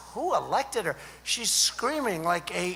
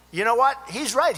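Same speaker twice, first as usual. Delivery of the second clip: normal talking voice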